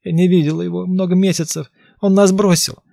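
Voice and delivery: high, loud desperate cry; desperate crying plea. Acted. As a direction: cry it out in despair. Beaten victim pleading.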